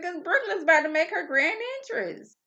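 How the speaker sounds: high, eager explanation; excited announcement. Retold or imitated. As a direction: tell them it's the big moment. Voicing hosts explaining.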